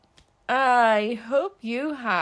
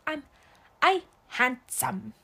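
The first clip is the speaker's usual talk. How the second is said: put-on toddler repeating; 3 semitones higher than usual. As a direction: imitate a little kid echoing "I handsome". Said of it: "repeats the words that I say".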